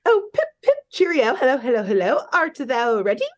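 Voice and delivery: little high voice with mock-British cheer; high-pitched British voice. Big Ben greeting his challenger, little high-pitched British voice. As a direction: put on a squeaky-high British greeting, starting highest. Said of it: "trying to do a high-pitched voice".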